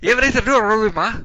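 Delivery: mumbling gangster grilling his ma; Mickey questioning. Acted. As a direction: press his mother hard and loud.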